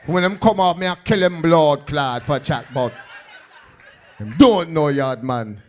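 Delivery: mock a tough Jamaican street guy; lightly put-on Jamaican accent. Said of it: "They gonna be like".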